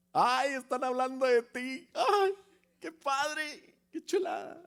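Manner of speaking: hammed-up rapture, squealing with joy; mock delight. Preacher mocking a believer who rejoices when slandered, shrill mock glee. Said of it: "Así dices".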